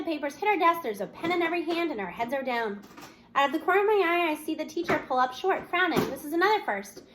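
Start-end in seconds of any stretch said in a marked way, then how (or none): none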